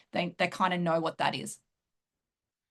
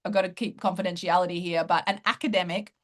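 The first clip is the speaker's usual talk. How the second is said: ordinary talk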